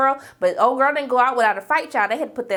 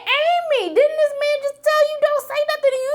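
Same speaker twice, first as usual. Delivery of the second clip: high, exasperated scolding of a TV character; pitched-up fed-up fan yelling at the screen; 3 dB louder than usual. Retold or imitated. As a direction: squeal at her in disbelief.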